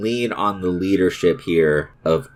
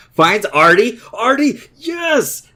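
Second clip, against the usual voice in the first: excited shout; 12 semitones higher, 4 dB louder, slower than usual. Real, not acted. Scoring a goal, celebrating.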